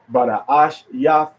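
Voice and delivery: slow "Bada Ashiath", leaning on ASH; teacher's slow demo of "Bada Ashiath", next-to-last syllable stressed. Shown as a demonstration. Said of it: "put the accent on the next to the last syllable".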